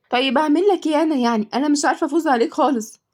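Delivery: high-pitched helpless protest; exasperated complaint. Acted. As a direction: whine that you just cannot win. Losing player complains to boy.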